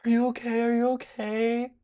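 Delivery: high-pitched fuss of caring friends; mock concerned classmates; worried classmates. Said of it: "comes out and is like".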